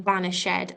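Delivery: "vanished" said in three syllables, BAN-ish-ED; extra syllable. Shown as a demonstration. Teacher explaining the accent on the word, extra syllable in "vanished". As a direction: sound the accented -ed of "vanished" separately. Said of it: "adds an extra syllable".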